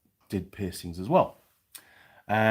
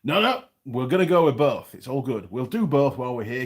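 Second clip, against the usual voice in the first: louder, higher put-on Clarkson accent; 4 semitones higher, 6 dB louder than usual. Customer insisting on both ears, imitated Clarkson accent. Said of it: "put on my best Clarkson accent".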